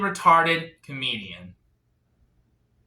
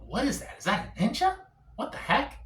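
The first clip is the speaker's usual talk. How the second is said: puzzled thought; 6 semitones higher, 6 dB quieter than usual. Retold or imitated. Acting his puzzled thought to himself.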